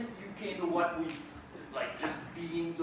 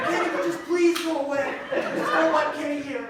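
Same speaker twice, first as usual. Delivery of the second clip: higher and louder, laughing annoyance; mock exasperated whine; exasperated pleading; 8 semitones higher, 9 dB louder than usual. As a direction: beg him to leave, half laughing. Wishing Kenny gone.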